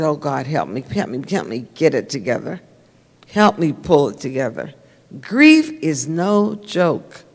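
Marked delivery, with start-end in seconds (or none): 0.0-4.7 hushed retold prayer. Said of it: "And I said".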